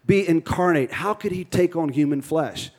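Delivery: usual speaking voice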